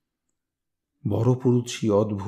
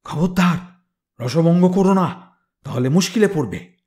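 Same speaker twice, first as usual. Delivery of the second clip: higher, louder irritated snap; angry warning; 6 semitones higher, 3 dB louder than usual. Acted. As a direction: warn them off for spoiling the music. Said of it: "চোটে বললে". (রেগে সাবধান করা)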